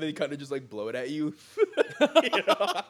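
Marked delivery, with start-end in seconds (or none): 1.4-2.2 loud high cackle; real laughter, loud and high; high burst of laughter. Real, not acted. Laughing at coughing image.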